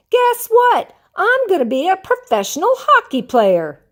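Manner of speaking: bursting to tell; excited news. Acted. SpongeBob telling Patrick his news, excited.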